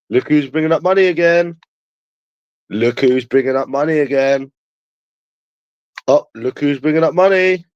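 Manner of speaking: high-pitched, repeated jeering; mocking taunt. Streamer mocking those who bring up money, high taunting.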